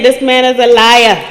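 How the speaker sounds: loud, high drawn-out cry to flee; urgent warning. Acted. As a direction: sound the alarm, stretching the words out. Acting out intuition's urgent warning.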